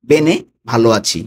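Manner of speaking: usual speaking voice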